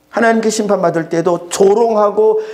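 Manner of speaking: ordinary delivery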